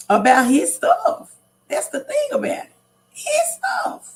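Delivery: pained cry over a man killed for his belongings; anguished outcry. Real, not acted. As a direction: let the outrage break into a wail. Outraged he was killed over property.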